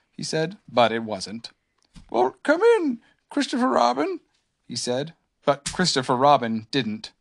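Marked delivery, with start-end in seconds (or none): 2.1-4.2 high-pitched storybook bear; put-on high voice, calling out; Pooh. A narrator's reading. Pooh calls to the door from bed, high voice. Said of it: "said Pooh sleepily".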